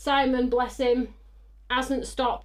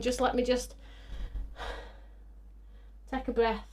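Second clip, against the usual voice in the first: ordinary talk, nothing marked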